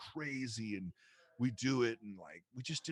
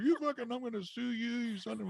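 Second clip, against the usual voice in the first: mock rant; 13 semitones higher than usual. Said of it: "Didn't tell Broder".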